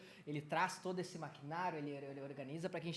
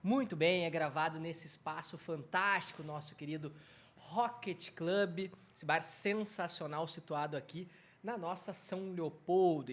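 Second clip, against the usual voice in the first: loud, drawn-out show opening; podcast host intro; 10 dB louder, slower than usual. Acted. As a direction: announce the show like a radio host. Podcast opening over music.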